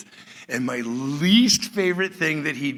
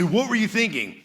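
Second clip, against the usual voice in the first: milder retelling of dad's rebuke; father's question retold more calmly; imitating dad; 8 semitones higher than usual. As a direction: lighter quote of the same question. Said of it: "He'd say".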